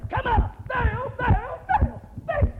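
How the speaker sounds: high, pleading calls to Baal; a preacher mimicking pagan priests begging their god; frantic Baal priests. Preacher mimicking priests crying out to Baal, high and pleading.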